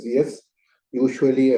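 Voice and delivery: usual speaking voice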